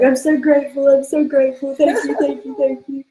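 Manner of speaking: high, gushing thanks; gushing gratitude. Retold or imitated. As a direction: lift the voice up high, overflowing with thanks.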